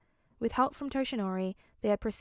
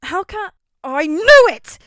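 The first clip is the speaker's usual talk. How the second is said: much louder, higher shout; 9 semitones higher, 18 dB louder, slower than usual. A narrator's reading. Todoroki shouting from the middle, loud. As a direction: blurt it out in shock. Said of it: "Todoroki shouted".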